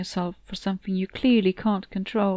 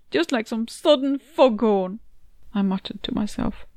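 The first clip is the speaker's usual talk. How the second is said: ordinary delivery, nothing marked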